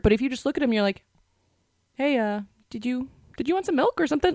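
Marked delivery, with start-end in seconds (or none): 1.9-4.3 put-on higher-pitched, casual offer to a child; breezily distract the kid, unbothered; acting like it's nothing. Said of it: "act like it was nothing".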